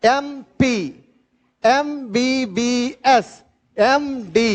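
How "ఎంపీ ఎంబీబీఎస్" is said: ఎంపీ as "em-pee" and ఎంబీబీఎస్ as "em-bee-bee-es", letter by letter; teacher slowly pronounces the abbreviations for the class; slow drilled pronunciation. Shown as a demonstration. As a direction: spell out ఎంబీబీఎస్ slowly, opening on the vowel "e". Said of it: "అన్నిటిని పలకండి".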